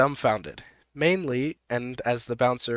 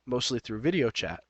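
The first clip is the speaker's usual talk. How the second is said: ordinary delivery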